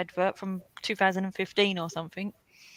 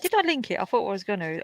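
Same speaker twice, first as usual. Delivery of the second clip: ordinary delivery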